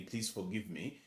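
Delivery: ordinary talk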